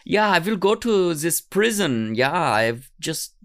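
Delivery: cartoonish German English; German accent. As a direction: play a hapless fugitive with a mock German accent. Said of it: "I don't know why he has a German accent".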